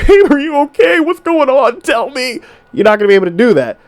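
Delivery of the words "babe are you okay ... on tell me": higher, overly tender mock concern; mock sappy boyfriend. Man mocking a boyfriend who cries along with his girl, in a higher, sappy voice. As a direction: ham up the weepy sympathetic boyfriend. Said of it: "I'm not gonna be able to cry for her and be like".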